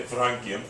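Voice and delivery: "francium" said softly with a k in place of the s; mock-English "francium", starting like frank; British-style pronunciation. Shown as a demonstration. Lecturer repeating how the English would say it, British-style pronunciation. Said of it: "as the English would say".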